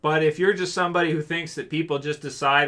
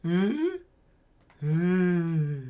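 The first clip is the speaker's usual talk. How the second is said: clueless zombie voice; 3 dB quieter than usual. Pretend. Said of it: "My response is".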